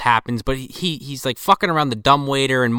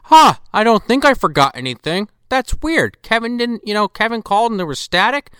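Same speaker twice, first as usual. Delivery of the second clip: high, puzzled movie dad; loud, punched "huh", mock pondering; John Hurd impression; 8 semitones higher, 4 dB louder than usual. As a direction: imitate a dad puzzling it over, pitched up. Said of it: "his best John Hurd fucking impression".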